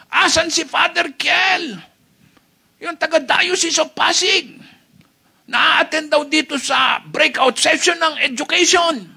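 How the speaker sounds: high, hammed-up menacing shout; shouting gunman. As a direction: yell as a gunman hunting someone down. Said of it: "sumisigaw yung namaril". (pasigaw na parang namamaril na may hinahanap)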